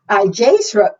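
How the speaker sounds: ordinary delivery, nothing marked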